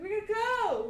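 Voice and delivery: urgent call. Acted. Child urging friend to lunch.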